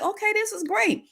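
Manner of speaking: a sudden high, bright-eyed okay; delighted realization. Retold or imitated. Retelling her relief after journaling, lightly delighted. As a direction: catch the lift of finding the answer.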